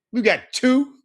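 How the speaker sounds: ordinary talk, nothing marked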